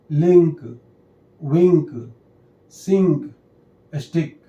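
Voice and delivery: बिल, हिल, सिंक read singly, each i sounded like ई; short-i word list. Shown as a demonstration. Teacher reading examples of the i rule, pronunciation demo. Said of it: "उसका sound भी ई जैसे ही होता है".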